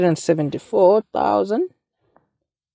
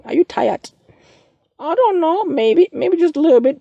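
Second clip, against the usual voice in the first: playful high voice; 11 semitones higher than usual. Real, not acted. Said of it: "I'm yawning here".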